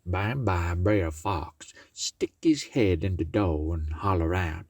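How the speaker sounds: ordinary delivery, nothing marked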